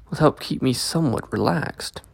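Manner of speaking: plain reading voice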